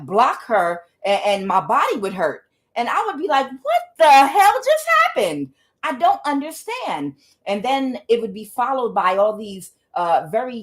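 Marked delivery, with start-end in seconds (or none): 3.5-7.1 high-pitched, incredulous outcry; retold bafflement, pitched way up; bewildered. Woman recalling her reaction to sister's attacks, bewildered.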